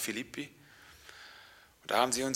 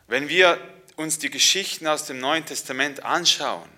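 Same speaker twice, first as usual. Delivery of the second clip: ordinary talk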